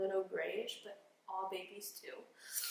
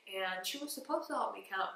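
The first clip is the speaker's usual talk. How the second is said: ordinary talk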